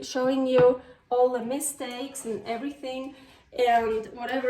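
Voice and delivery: normal talking voice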